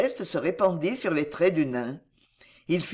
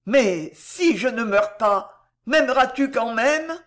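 slow, louder anxious question, rising higher; heartrending anxiety; 5 semitones higher, 5 dB louder than usual. A narrator's reading. Wounded man asks if she will still love him, anxious. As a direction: ask pleadingly, worried by the answer. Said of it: "avec une inquiétude navrante".

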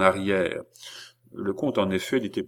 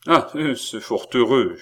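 ordinary delivery, nothing marked

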